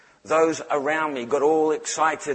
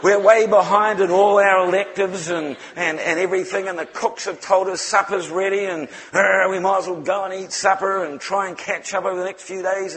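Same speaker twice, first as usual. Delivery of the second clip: louder, higher mock exasperation; frantic organizer; 4 semitones higher, 6 dB louder than usual. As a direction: act out a flustered organizer complaining. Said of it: "this guy was just off his face".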